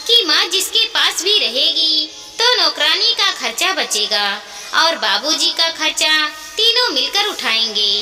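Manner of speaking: ordinary delivery, nothing marked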